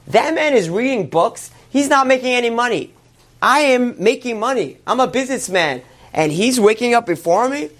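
high, emphatic competitive protest; indignant disbelief. Acted. Businessman indignant at scholar rising earlier.

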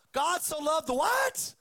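"what" shouted high and loud as a question; shouted correction question. Shown as a demonstration. Preacher correcting the misquote, shouting the question at the crowd. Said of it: "one of the most misquoted verses".

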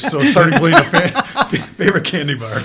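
cracking up mid-question; laughing. Real, not acted. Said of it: "You can't do it".

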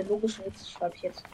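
ordinary talk, nothing marked

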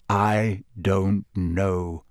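very slow, weighing each word; slow and hesitant. A narrator's reading. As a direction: drag it out, unsure. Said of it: "said Sirius slowly".

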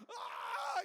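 high, loud re-enacted scream. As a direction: scream as if plunging on a coaster. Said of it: "I'm screaming".

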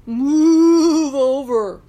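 loud, slow mooing command; long drawn-out cow moo on the first word; bossy cow order. A narrator's reading. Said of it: "ordered Cow".

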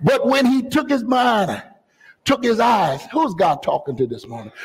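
normal talking voice

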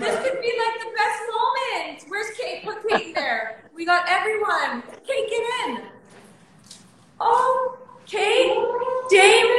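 very high, giddy voice with laughter; overjoyed. Real, not acted. Guest gathering friends for the best moment, overjoyed. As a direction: pitch it way up, overcome with delight.

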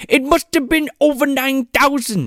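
Bill Cosby impression. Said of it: "doing his best fucking Bill Cosby".

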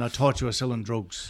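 put-on soft Irish movie-quote accent. Man repeating a line from The Commitments, soft Irish accent. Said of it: "that's from The Commitments".

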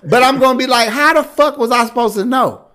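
high, loud, laughing rant; excited rant. Real, not acted. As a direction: get worked up and laugh through it. Hyped, laughing rant about being twenty-one.

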